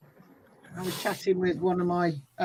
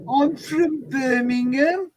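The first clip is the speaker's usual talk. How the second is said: put-on Dudley accent; 6 semitones higher, slower than usual. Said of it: "No, that's a Dudley accent".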